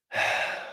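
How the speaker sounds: a long unvoiced heavy sigh. Real, not acted. Father talking about missing his kids, heavy sigh. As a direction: let out the breath of a painful memory. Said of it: "You can hear me sighing a lot".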